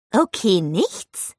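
slow, incredulous repeat with rising end; surprised. A narrator's reading. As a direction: echo it in drawn-out surprise. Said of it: "wiederholte Olivia überrascht". (überrascht und gedehnt wiederholt)

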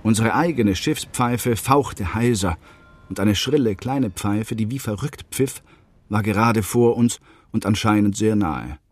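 plain reading voice

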